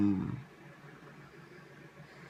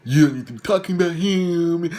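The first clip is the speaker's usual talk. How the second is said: scolding man imitated; 7 semitones higher, 4 dB louder than usual. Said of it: "And he was like".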